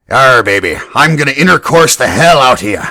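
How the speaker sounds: loud growling pirate come-on. Acted. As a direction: ham it up like a lusty pirate. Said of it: "It doesn't even sound sexy and pirate".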